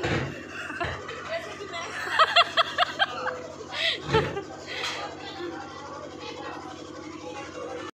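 long, high laugh that tails off. Real, not acted. Vlogger laughing at the waiting dancers, high.

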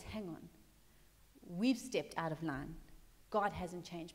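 low, hushed dawning dread; softly acted moment of alarm; understated fearful realization. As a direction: drop to a quiet, sobered voice. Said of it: "all of a sudden he becomes afraid".

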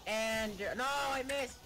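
high frustrated yelp; frustrated shout. Real, not acted. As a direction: yelp at the miss. Trick fails.

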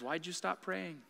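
soft, slow sweet voice; sweetly asking, barely above a breath. Retold or imitated. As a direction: coax it gently and slowly. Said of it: "this sweet voice came back and goes".

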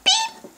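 short, very high imitated beep; scanner beep. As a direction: mimic a checkout scanner.

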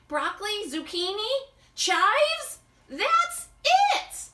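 a chef finding the missing ingredient; excited chef. Acted. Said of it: "Max is even speaking like a chef".

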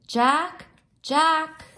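dad calling for his son. Acted. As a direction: call across the store.

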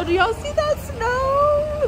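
high, slow and amazed; excited. Real, not acted. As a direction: marvel at the snow. First sight of snow, excited.

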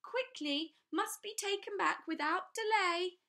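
a nervous little man pressing the point; high voice. A narrator's reading. Mister Lowe insists to the class in a high squeak. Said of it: "in a high voice".

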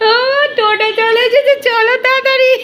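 very high, loud playful call; imitating grandma's call. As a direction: hurry a child along in a high singsong. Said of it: "ওই যে দিদুন বলছে". (দিদুনের নকলে চড়া আদুরে ডাক)

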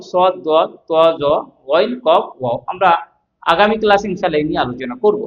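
usual speaking voice

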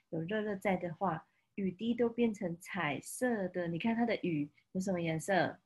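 ordinary delivery, nothing marked

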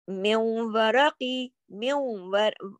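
"मिन वरकी" slowly as "miw-waraqi"; idgham into waw. Shown as a demonstration. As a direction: draw the n of "मिन वरकी" into a long nasal w. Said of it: "नून साकिन के बाद वाव".

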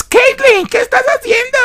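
voz de papá mucho más aguda, en burla; papás burlones. Retold or imitated. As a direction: imita a los papás con falsete exagerado. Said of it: "Y los papás". (remedo agudo y burlón de los papás)

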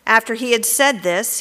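ordinary talk

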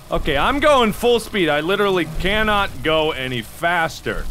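normal talking voice

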